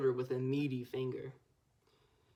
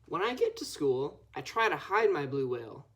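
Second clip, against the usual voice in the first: usual speaking voice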